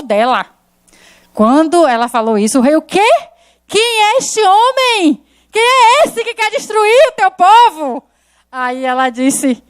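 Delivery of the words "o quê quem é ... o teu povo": high, slow outraged king, drawn out; a king who cannot believe his ears; shocked king. Retold or imitated. King learns someone threatens Esther.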